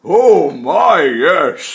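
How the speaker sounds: put-on loud, jolly old tree voice; woody tree voice. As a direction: speak like a giant, cheerful old tree. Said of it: "deep, woody-sounding words came out".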